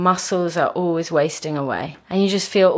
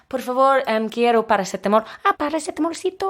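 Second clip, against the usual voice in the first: Chilean Spanish; 4 semitones higher, faster than usual. Retold or imitated. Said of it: "So it'd be like".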